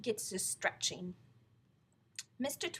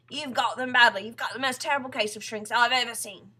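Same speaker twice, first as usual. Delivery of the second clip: loud, dramatic diagnosis; alarmist Mr Twit; 3 semitones higher, 14 dB louder than usual. Acted. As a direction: pile on the dread. Voices Mr Twit's dire diagnosis.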